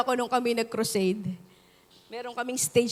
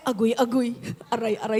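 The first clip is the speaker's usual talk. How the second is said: a hint of a hurt man groaning; mock groaning; 2 semitones lower, faster than usual. Fallen song leader moaning after the stage collapse, mock groans. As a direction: lightly play the fallen man's moans. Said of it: "may maya-maya".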